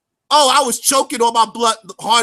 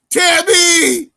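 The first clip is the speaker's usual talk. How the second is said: calling out; 5 semitones higher than usual. Real, not acted. Calling out to Tammy.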